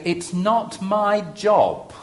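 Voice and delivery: blunt and defiant, slowly drawn out; bolshy. Shown as a demonstration. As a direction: say it stubbornly as the pastor. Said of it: "I'm gonna be a bit bolshy here as the pastor".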